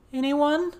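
high, loud frightened calls; scared calling. A narrator's reading. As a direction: call for friends, growing scared. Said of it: "Avery called out".

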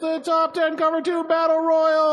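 high, drawn-out announcer bellow; ring announcer. Acted. Host announcing the top ten battle royal, ring-announcer bellow. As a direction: stretch it out like a battle royal introduction.

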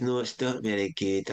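lightly imitated Scottish accent. Comedy head rejecting the writers' idea, imitated Scottish accent. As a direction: reject it in a mild Scots accent. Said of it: "I was Scottish, by the way".

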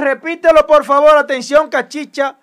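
loud, high hype call; excited shout. Real, not acted. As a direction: shout it like breaking news. Host hyping a scoop.

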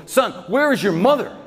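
high-pitched, insistent demand; acted desperation, pitch leaping up; desperate demand. Father shaking his oldest son for an answer, desperate.

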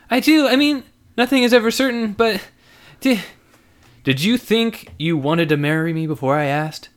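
high, loud flustered woman. Acted. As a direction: caught out, stumbling over words. Lizzie flustered.

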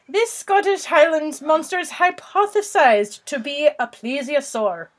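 Scottish lilt leaning Irish, high start that settles; mock Scottish accent. Reading about the monster on request, mock Scottish accent. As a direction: read it out in a faked Scottish brogue. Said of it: "read this in a Scottish accent".

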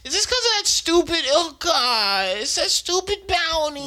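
high, fast exasperated whine; imitating Vash. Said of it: "He does say it exactly like that".

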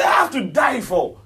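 loud, high, impassioned preacher's voice; emphatic declaration. Acted. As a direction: cry it out with full force. Repeats the thought emphatically.

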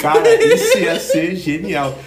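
slow, high, through laughter; laughing. Real, not acted. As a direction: crack up at the idea. Cracking up at the joke.

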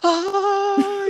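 high startled shriek imitating the brother; a sudden high fright cry; retold scream. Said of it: "le hace".

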